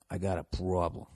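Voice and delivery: low, soft Boston accent. Shown as a demonstration. Host after a week in Boston, Boston accent. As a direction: slip back into a Boston accent. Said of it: "the Boston accent kinda came back".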